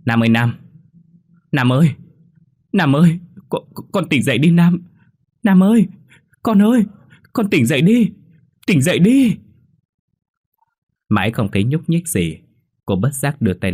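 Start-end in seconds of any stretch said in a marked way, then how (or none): 0.0-9.4 high, loud desperate pleading; desperate calling. A narrator's reading. Mother begging her unconscious son to wake, desperate. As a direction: call the boy back, voice rising in despair. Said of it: "cô kêu".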